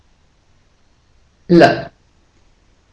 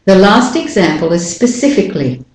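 ordinary talk

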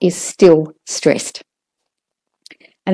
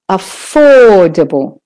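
"affordable" with a long, loud OR; stress on OR; 3 dB louder than usual. Shown as a demonstration. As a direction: stretch the second syllable of "affordable", relax the rest. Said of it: "make the or ... longer, louder".

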